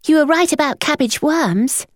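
put-on high boy's voice with a grin; pleased with himself; grinning boy. A narrator's reading. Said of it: "he said, grinning".

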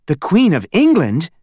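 mother hearing the Queen mentioned; mother's surprise. Acted. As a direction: echo it in surprised disbelief. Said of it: "repeated Missus Lambchop".

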